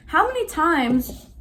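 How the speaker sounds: student venting at the professor, fed up; retold upset. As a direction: drag out each word in exasperation. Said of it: "I got so upset because I'm like".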